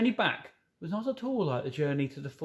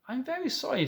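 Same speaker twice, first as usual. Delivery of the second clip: put-on little girl voice; 10 semitones higher than usual. High girl voice.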